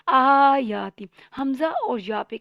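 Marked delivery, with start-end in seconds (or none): 0.1-1.0 Quran recitation. Shown as a demonstration. Teacher recites verse word.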